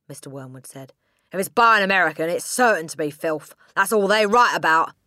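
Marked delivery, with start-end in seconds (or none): 1.3-4.9 loud, fast scornful tirade, higher; angry ranting father. A narrator's reading. Father ranting at Matilda over her book, loud and scornful. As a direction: spit out the contempt for the book.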